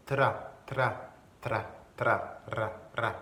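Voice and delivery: "tra" with a trilled R; spring from the T into a rolled R in "tra"; rolled R after T. Shown as a demonstration. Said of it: "en roulant le R". (« tra » en roulant le R)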